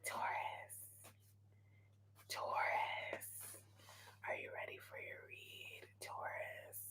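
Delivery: hushed, whispered call to the listener. Real, not acted. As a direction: whisper it softly, as if summoning them. Whispered call to Taurus viewers.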